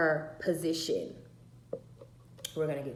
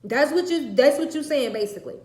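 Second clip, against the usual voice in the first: loud angry shouting, higher; furious yelling; 7 semitones higher, 13 dB louder than usual. Retold or imitated. Mother yelling at her daughter in the car, furious. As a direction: yell it at her, fed up.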